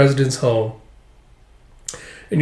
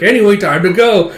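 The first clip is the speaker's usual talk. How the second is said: higher, louder, carefree brush-off; breezy dismissal; 6 semitones higher, 5 dB louder than usual. Retold or imitated. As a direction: wave it off and walk on.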